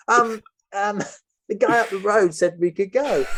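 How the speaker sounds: high, nervous mock excuse with a laugh; sheepish excuse. Tom excusing himself to the captors, high and sheepish. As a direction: sheepishly fumble an excuse to gunmen.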